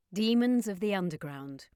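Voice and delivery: ordinary delivery, nothing marked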